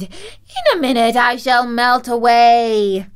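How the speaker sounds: high, loud wailing witch; loud, despairing witch cry; witch's scream. A narrator's reading. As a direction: wail as the witch melts. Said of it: "she screamed".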